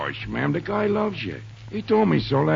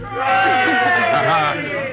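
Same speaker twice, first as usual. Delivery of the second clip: loud hearty laugh; 4 dB louder than usual. Acted. Prank revealed, Tug laughs.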